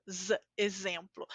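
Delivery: soft exemplo with a buzzing Z for the X. Shown as a demonstration. Teacher repeating the Z in exemplo quietly. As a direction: hum the Z in exemplo. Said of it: "A z".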